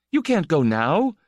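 fierce. A narrator's reading. Itale stops Estenskar leaving, fiercely. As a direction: block the door and refuse to let him leave. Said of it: "he said fiercely".

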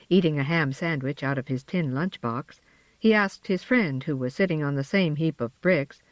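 usual speaking voice

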